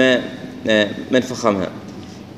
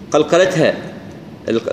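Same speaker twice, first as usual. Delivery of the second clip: ordinary delivery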